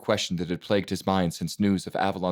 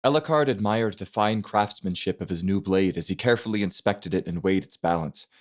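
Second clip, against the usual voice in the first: usual speaking voice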